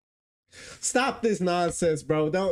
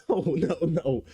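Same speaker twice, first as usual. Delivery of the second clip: usual speaking voice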